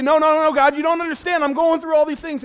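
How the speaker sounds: loud, high pleading complaint; mock protesting believer. Congregation's voice protesting loudly to God, high and whiny. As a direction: whine it at God in full protest. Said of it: "And we say".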